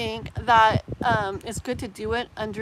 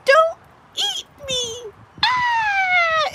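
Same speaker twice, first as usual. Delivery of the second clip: much higher, louder crying plea, don't eat me stretched out slowly; terrified toddler; 6 dB louder, much slower than usual. Retold or imitated. Mom imitating her toddler screaming at a huge dinosaur, high crying scream. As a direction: beg the dinosaur like a scared little kid near tears.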